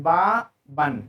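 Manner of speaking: बा बन said as two syllables, clear n at the end; split demo. Shown as a demonstration. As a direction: separate the syllables, ending on a clear n. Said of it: "pronunciation इस तरह करते हैं".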